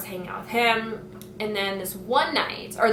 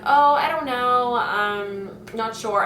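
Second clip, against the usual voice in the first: evasive; 2 semitones higher, slower than usual. Retold or imitated. Narrator dodging her controlling friend's plans, slow and evasive. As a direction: stall so you don't commit.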